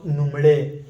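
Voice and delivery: نملي said as "numle", starting on n; variant with n. Shown as a demonstration. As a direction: say نملي as "num-LAY", n in place of l. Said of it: "أيضًا في النون".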